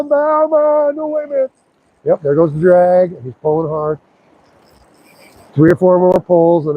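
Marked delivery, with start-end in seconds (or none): 0.0-1.5 higher, pleading fish voice; hooked fish pleading. Acted. Angler voicing the hooked trout, higher mock plea. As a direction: play the fish begging to be let go. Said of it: "And then he's gonna say".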